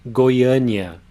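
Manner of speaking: Goiânia said goy-AH-nee-ah, stress on the second syllable; Portuguese pronunciation. Shown as a demonstration. Teacher models the capital of Goiás for students, stressing ÂN. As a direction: say Goiânia with the weight on ÂN. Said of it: "Here we have the vowel A".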